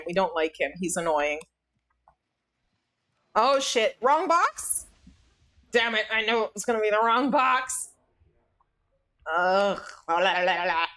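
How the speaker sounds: ordinary delivery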